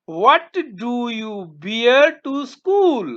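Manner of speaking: very slow, spaced words; slow question read. Shown as a demonstration. Teacher reading a question to pupils, very slow. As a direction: read the question slowly for the class. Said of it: "teacher reading question".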